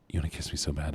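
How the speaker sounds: usual speaking voice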